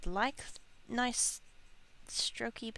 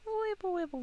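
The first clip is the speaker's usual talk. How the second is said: silly sing-song; 12 semitones higher than usual. Pretend. Playful noise while painting.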